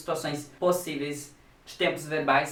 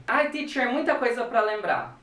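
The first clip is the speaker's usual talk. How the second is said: higher-pitched student protest to the teacher; complaining student; 5 semitones higher than usual. Acted.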